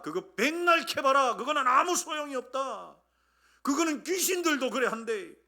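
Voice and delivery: loud, high-pitched dismissive rebuke; impassioned preacher's scolding; scornful scolding. Acted. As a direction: dismiss their empty claim forcefully. Acted scolding of empty belief.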